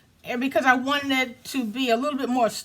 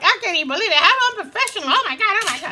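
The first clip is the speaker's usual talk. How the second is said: mock shocked viewer; 7 semitones higher, 6 dB louder, much faster than usual. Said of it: "You're like".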